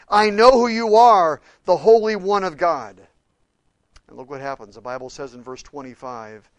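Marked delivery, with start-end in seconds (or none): 0.1-3.0 loud shouted demon's cry, KNOW hammered. Acted. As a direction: fling it out like a cornered demon. Demon's cry quoted in sermon.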